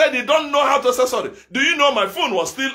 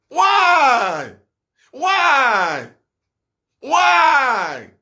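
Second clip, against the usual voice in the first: long drawn-out cry, falling away; lamenting wail; much slower than usual. Real, not acted. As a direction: wail each word out in anguish. Preacher lamenting, calling out.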